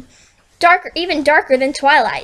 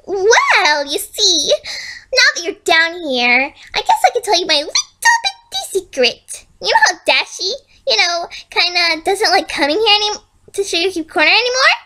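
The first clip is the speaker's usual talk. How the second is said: creepy sweetness; 6 semitones higher than usual. Acted. Villain shares creepy secret.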